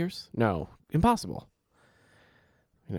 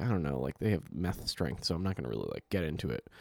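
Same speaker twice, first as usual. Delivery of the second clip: inner caution; 7 semitones lower, 9 dB quieter, faster than usual. Retold or imitated. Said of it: "but I was like".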